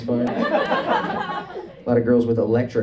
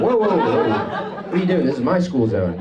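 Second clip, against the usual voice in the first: high-pitched protesting whoa; mock alarm; 5 semitones higher than usual. Rival confronting the boy on his turf, mock alarm. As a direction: stop an intruder in mock outrage.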